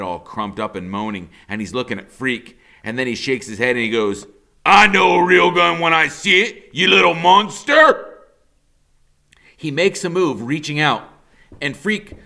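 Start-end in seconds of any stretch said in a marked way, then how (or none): 4.6-8.1 loud, mocking villain voice; sneering villain. A narrator's reading. Killer Kane mocking Freak, loud and sneering. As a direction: sneer it at the kid.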